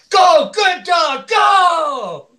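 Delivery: high, loud cheer with drawn-out words; excited cheer. Retold or imitated. Auctioneer reading out a bidder's rooting comment, excited cheer. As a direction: cheer it out loud, stretching each word. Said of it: "Skunk Girl says".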